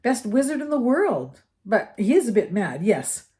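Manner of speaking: put-on breezy older schoolboy explaining; lightly voiced Percy. A narrator's reading. Percy answering.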